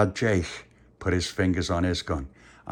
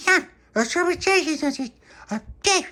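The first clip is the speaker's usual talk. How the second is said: put-on very high squeaky baby voice; tiny baby squeak; 3 dB louder, slower than usual. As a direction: babble like a toddler doll.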